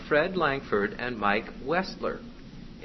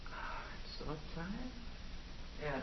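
very soft, drawn-out weary sigh; tired girl; 18 dB quieter, much slower than usual. Acted. Girl echoes the demon's suggestion, wearily. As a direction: breathe it out slowly, acting worn out. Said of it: "right in her ear, she goes".

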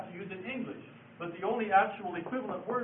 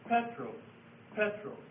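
PETROS, repeated with a raised first syllable; stress on first syllable; 3 semitones higher, slower than usual. Shown as a demonstration. Teacher repeating Petros to show the Greek accent mark, first-syllable stress. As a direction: hit the first syllable of Petros each time. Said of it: "the stress goes in the first syllable".

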